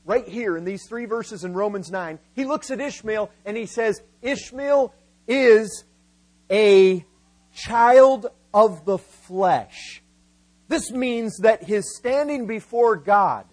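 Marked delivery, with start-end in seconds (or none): 4.2-10.0 slow, word-by-word declaration with pauses; emphatic pronouncement. Acted. Preacher quoting Paul's verdict on Ishmael, slow and emphatic. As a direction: land each word separately and firmly.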